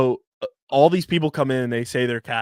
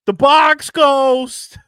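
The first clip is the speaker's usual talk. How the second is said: Box Ghost voice; 12 semitones higher, 8 dB louder, much slower than usual. Retold or imitated. Recalling cartoon villain's catchphrase voice.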